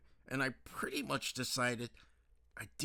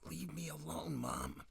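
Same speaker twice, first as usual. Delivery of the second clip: hoarse, croaky daughter; 5 semitones higher than usual. Acted. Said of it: "you got a frog in your throat".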